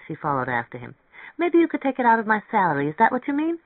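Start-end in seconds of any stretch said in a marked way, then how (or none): 1.4-3.6 high, quick, hopeful questioning; anxious young woman. A narrator's reading. Mary Ann follows him offering her salary, high and anxious. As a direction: hurry out the suggestion, pitched high.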